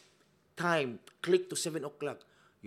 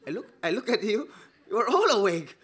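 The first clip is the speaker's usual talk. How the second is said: laughing; 7 semitones higher, 5 dB louder than usual. Real, not acted. Preacher laughing at congregation.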